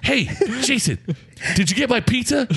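high-pitched, teasing wake-up call; giggly pestering friend. Retold or imitated. Al waking his roommate about pizza, high and giggly. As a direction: pester a sleeper in a high silly voice.

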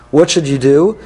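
usual speaking voice

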